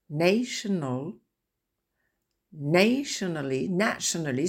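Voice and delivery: teacher's pronunciation word list; word-stress drill. Shown as a demonstration. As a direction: model each word's stress one by one. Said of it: "la musique du mot".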